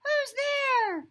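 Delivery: high little mouse calling out; a small startled squeak; startled mouse cry. Acted. Mouse calling out at glowing eyes, high and startled. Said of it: "Mouse cries".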